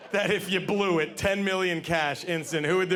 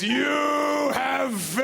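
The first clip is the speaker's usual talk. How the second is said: slow, booming demon verdict; demonic voice; 6 semitones higher, 3 dB louder, much slower than usual. Acted. Temple demon judges the failed seeker, slow booming voice. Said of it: "this demonic voice".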